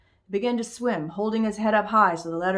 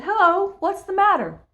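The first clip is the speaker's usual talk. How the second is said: put-on high, bright child voice; a small boy asking kindly; little boy voice; 12 semitones higher, 7 dB louder than usual. A narrator's reading. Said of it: "The little boy".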